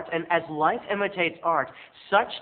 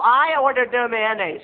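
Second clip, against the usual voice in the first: higher, louder clipped complaint; indignant mom; 6 semitones higher, 7 dB louder than usual. Retold or imitated. Mom complaining to window clerk, indignant and loud. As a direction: demand it back sharply. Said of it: "she said to the man".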